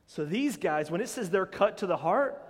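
ordinary delivery

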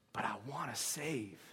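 low, soft, slightly hushed confession; God speaking to Son. Acted. God admits to his Son a wish to save, low and quiet. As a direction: drop right down, softly. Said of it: "and God says".

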